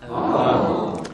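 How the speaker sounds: low breathy fascinated Oh. Pretend. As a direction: hush out a rapt Oh. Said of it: "I'm fascinated".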